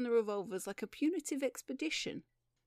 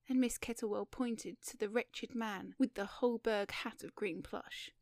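plain reading voice

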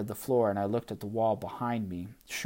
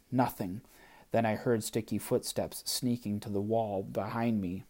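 plain reading voice

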